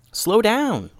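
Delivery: high-pitched urging; urgent caution. Acted. Ninja holds back her hurrying partner, urgent caution.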